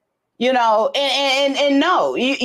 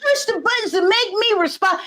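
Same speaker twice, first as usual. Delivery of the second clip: mock accusing; 10 semitones higher than usual. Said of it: "This kinda how I said".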